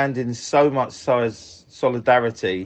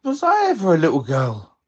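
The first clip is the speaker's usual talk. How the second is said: higher-pitched imitation of mum; mum's questioning voice; 4 semitones higher than usual. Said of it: "she asked me a question".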